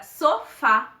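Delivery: "sofá" slowly, with a strong final "fá"; stress on final syllable. Shown as a demonstration. Teacher giving an oxytone example, "sofá" stressed on "fá". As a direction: land firmly on the "fá" of "sofá". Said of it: "A sílaba mais forte é o fá".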